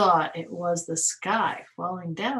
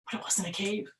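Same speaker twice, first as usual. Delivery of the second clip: ordinary delivery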